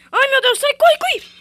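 very loud, high shrieks to run; panicked scream. Real, not acted. Gamer shrieking to run as an enemy closes in.

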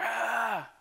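high, held crowd noise; imitated crowd roar. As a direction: mimic a stadium roar without real volume. Imitates twenty-five thousand people roaring.

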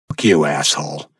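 ordinary delivery

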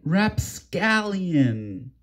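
slow, drawn-out rapscallion; mock pirate word. A friend urged to say it, slow pirate word. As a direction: stretch rapscallion out slowly. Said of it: "Say it, say it".

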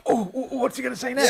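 excited anticipation. Retold or imitated. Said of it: "You're like".

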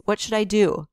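ordinary delivery, nothing marked

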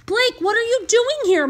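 high-pitched, puzzled question; confused. Acted. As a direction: ask him in mild bewilderment. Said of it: "Martha looked confused".

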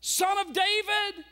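high, loud desperate plea; crying out. Acted. Blind Bartimaeus cries out to Jesus, loud desperate plea. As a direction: cry out for mercy with all you have. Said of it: "he began to cry out".

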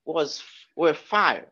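normal talking voice

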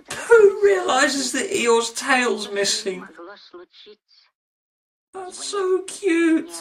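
high, slow, mock-weepy squeal; tearful cooing. Reviewer moved by Eeyore's missing tail, mock-tearful cooing. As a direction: melt into a hammed-up tearful coo.

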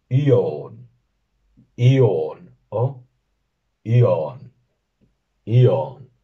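teacher giving two accepted sounds for son; two pronunciations. Shown as a demonstration. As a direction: contrast the two readings of "Ion". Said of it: "la O se pronunciaría como una A bastante profunda".

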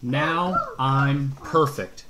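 slow, smug brag; boastful. A narrator's reading. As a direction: Coyote brags to the crows, slowly. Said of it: "rude and boastful".

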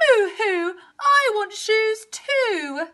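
high, drawn-out moo-hoo wail; loud tearful cow whine; bawling cow. A narrator's reading. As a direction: cry it slowly like a begging cow. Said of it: "bawled Cow".

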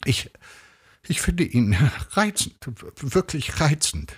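halting, high stammer of praise; strained, stuttering false enthusiasm; forced out. A narrator's reading. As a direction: struggle for each word, voice high. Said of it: "stieß sie hervor".

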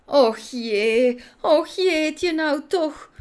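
high, slow despairing moan; groaning, at her wits' end. A narrator's reading. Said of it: "kreunde juffrouw Cassandra wanhopig".

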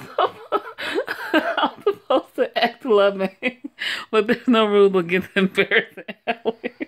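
loud, high, cracking up; laughing while reading. Real, not acted. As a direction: try to read on through real laughter.

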